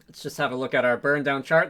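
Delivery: ordinary delivery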